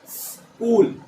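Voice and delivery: "स्कूल" as a long hiss, a break, then "kool"; breathed-out s. Shown as a demonstration. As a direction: draw out the s in "स्कूल" very slowly. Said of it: "आपको ऐसे बोलना है". ("स्कूल" में सांस छोड़ता लंबा स)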